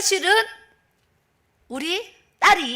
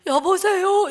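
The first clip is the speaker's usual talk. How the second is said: tearful answer; 7 semitones higher, 4 dB louder, much slower than usual. Retold or imitated. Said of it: "울다가 받는 목소리".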